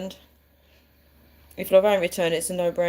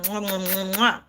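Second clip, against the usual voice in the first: blown kisses. Real, not acted. Blowing kisses to viewers.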